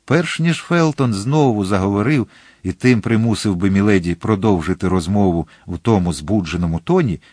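ordinary delivery

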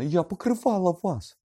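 piercing, loud reproach; shrieking. A narrator's reading. Hermione says she covered for Lupin, shrieking. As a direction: shriek the accusation at them. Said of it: "заверещала Герміона".

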